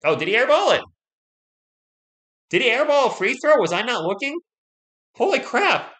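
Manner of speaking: can't believe he missed it; real shock. Real, not acted. Streamer realizes he missed the airball, in loud disbelief.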